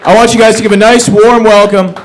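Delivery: loud, high MC intro; booming emcee introduction; host hype. Acted. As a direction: pump up the crowd for the next act.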